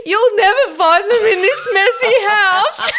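put-on high, loud, slow kid voice cracking with laughter; laughing mock kid shout. Kid teasing mum about messy house, laughing shout. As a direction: half-shout it through laughs like a cheeky child.

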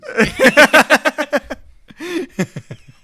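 loud, high laughing fit; big helpless laugh; burst of laughter. Real, not acted. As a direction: crack up at your own joke. Laughing at own crazy joke.

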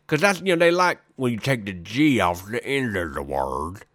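folksy country huckster character; Quick Mickey. Acted. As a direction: put on a drawling backwoods pitchman. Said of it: "Quick Mickey is going to let you know what happens".

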